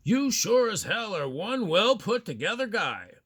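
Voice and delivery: loud, high-pitched admiring call from behind. Retold or imitated. Disembodied voice hailing a man from behind on the street, calling out. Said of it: "a disembodied voice from behind me calls out".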